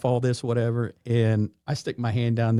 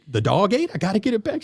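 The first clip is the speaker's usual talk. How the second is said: sheepish joker; 9 semitones higher, faster than usual. Retold or imitated. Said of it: "He was like".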